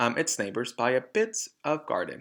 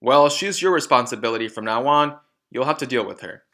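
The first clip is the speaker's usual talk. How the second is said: much louder and fast, scornful; sneering loud father; 8 dB louder than usual. Acted. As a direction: fire it off with scorn. Rude father dismissive.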